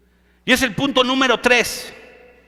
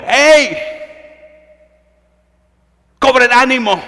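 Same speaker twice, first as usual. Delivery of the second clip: louder, rallying call to the crew; Paul calling out to the frightened sailors, loud; 2 semitones higher, 5 dB louder than usual. Acted. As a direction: call out over the storm to lift spirits. Preacher voices Paul calling out.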